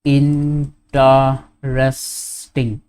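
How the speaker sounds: "Interesting" very slow, in four separate syllables. Shown as a demonstration. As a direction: break "Interesting" into in-ter-es-ting with gaps. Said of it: "four syllables".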